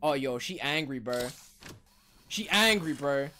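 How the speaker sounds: low-pitched, uneasy remark; subdued dread. Real, not acted. Player noticing the monster is angry, subdued. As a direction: say it under the breath, warily. Muttered first, then cried out.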